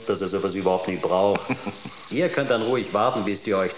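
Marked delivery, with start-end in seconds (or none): 1.5-2.0 quick soft breathy laugh; a short airless snicker. Acted. Teasing the crew about programmed girlfriends, short breathy snicker.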